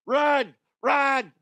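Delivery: high, loud panicked cry of run; mock fleeing yell. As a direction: yell it as if running for your life.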